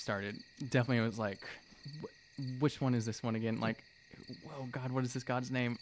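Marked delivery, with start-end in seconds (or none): none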